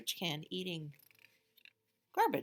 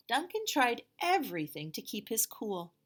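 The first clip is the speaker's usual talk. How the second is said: lively read-aloud voice, a bit louder; picture book read to children; storybook narration; 3 semitones higher, 6 dB louder than usual. A narrator's reading. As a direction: tell it to the kids with big energy.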